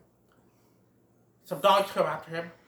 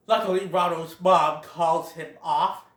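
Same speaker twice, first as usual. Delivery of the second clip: normal talking voice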